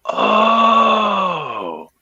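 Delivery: long, very high "oh" sliding down; exaggerated retold revelation; dawning realization. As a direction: draw it out high as it finally clicks. Retold moment of finally understanding.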